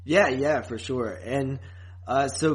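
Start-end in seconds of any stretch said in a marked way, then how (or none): none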